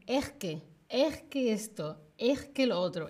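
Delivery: "Es que" a la madrileña, la s aspirada como jota: "ejque"; acento madrileño. Shown as a demonstration. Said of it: "Ese es el acento que tienen". (acento de Madrid, "es que" con jota)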